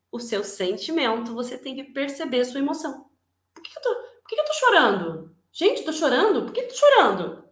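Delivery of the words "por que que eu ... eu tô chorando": higher, puzzled self-questioning; surprised at own tears. Pretend. Person noticing own tears, higher and puzzled. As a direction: act baffled at sudden tears.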